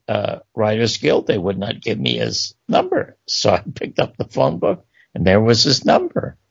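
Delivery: ordinary delivery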